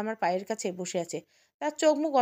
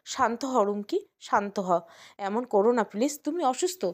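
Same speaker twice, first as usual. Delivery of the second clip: plain reading voice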